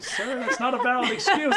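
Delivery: high-pitched mock officer, half laughing; lightly voiced officer holding back laughter. FBI agent answering the man in the tree, mock official.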